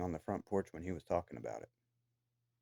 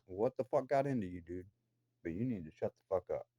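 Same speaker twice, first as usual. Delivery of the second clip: ordinary talk, nothing marked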